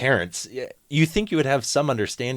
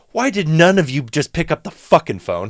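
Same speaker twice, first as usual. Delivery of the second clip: higher, louder frustration; exasperated; 7 semitones higher, 7 dB louder than usual. Real, not acted. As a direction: throw up your hands at it. Exasperated outburst.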